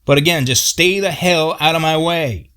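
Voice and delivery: high, heated rant; Paul angrily correcting Robert about the tree; angry. Retold or imitated. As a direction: snap back hotly. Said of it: "Paul got really angry".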